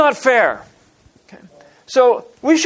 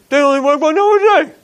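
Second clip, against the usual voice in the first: indignant complaint; 6 semitones higher than usual. Retold or imitated. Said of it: "That's exactly how they talk".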